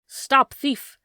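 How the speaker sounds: high, loud shout; crying out. A narrator's reading. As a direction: yell after the fleeing thief. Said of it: "he cried".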